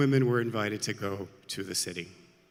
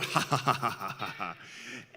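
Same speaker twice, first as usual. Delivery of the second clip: staccato mock ha-ha laugh; performed mocking laughter; scornful laugh; 2 semitones lower than usual. A narrator's reading. Proud sister laughs back at the trees, scornful. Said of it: "I laugh at you".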